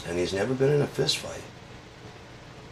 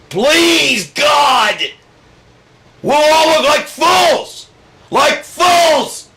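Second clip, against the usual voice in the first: high, loud, pleading shriek; desperate Mensa; 11 dB louder, much slower than usual. Acted. Mensa begging Donald to hide his grades, high pleading shriek. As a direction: beg him at a shriek.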